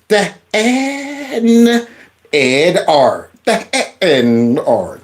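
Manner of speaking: "The N, ed, R" in separated, drawn-out chunks; laboured sounding out. Shown as a demonstration. Host retrying surname "The N, ed, R", laboured sounding out. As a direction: piece together "The N, ed, R", stretching the N. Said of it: "I'm going for it again now".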